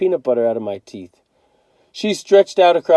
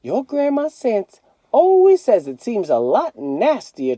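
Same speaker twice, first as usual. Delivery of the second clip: Mama; 9 semitones higher than usual. A narrator's reading. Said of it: "Mama said".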